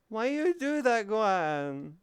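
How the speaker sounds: yelping at a friend who startled you; startled high protest. Real, not acted. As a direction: caught off guard by a friend. Startled reaction.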